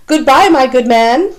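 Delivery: put-on a lofty old-lady goodbye; Hilda's voice. A narrator's reading. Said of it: "Hilda says to Charlie".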